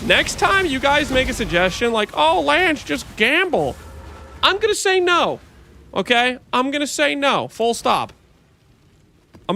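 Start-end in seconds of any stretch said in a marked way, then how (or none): 0.1-8.1 high, emphatic scolding; scolding lecture. Real, not acted. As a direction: lay down the law to chat. Lecturing the chat.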